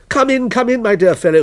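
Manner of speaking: high, loud eager greeting; exclaiming welcome. A narrator's reading. Host welcoming the cold policeman in, eager. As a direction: call him in with open delight. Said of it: "he exclaimed".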